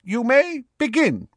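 very high, carrying professor voice; examiner calling out. Acted. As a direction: start the exam with a formal call. Examiner starts the exam.

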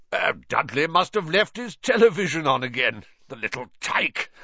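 hearty, loud call through the house; jovial uncle calling. Acted. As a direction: call out like a cheery host.

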